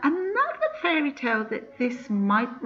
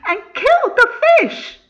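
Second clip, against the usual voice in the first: laughing; 11 dB louder than usual. A narrator's reading. Narrator laughs at cruel deed.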